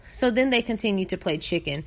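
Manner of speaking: normal talking voice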